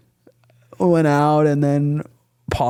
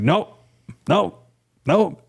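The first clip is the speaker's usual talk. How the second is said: higher, curt repeated nopes; mock uncle; 6 semitones higher, much slower than usual. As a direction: reject each stroke sharply. Uncle frustrated correcting.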